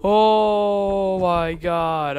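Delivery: loud, drawn-out cry of dread; alarmed. Real, not acted. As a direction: wail it slowly, high and alarmed. Frightened reaction to the game.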